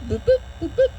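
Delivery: high, soft double beeps; little high boops in heartbeat rhythm; imitated monitor beeps. Patient mimics her heart coming back, high soft beeps.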